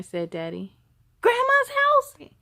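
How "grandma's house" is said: much higher, louder, slow surprised cry; delighted disbelief; boy recognizing grandma's house, surprised yell. A narrator's reading. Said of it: "B J yelled".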